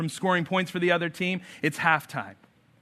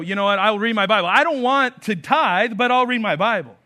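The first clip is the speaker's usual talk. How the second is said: louder, higher mock churchgoer making excuses; 5 semitones higher, 7 dB louder than usual. Pastor voicing a believer who picks the easy duties, mocking. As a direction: play a half-hearted believer bargaining.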